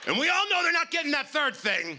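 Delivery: punchline shout. Real, not acted.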